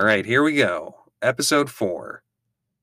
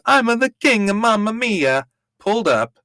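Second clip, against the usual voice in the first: high, louder mock Italian accent; 10 semitones higher, 4 dB louder than usual. Host obeying the title's instruction, hammed-up Italian accent. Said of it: "please say in Italian accent".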